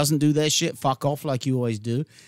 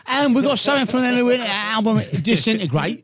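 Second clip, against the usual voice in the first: hammed-up Cockney-style album plug; exaggerated English accent; 4 semitones higher than usual. Shown as a demonstration. Plugging the band's new album, in exaggerated English accent. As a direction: plug it in broad, playful English. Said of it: "If I go back to my English way".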